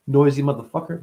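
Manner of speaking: ordinary talk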